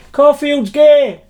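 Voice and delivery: high, loud, drawn-out yell; imitated heckler shout. Retelling a heckle at the soundcheck, loud high mock shout. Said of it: "Somebody shouted".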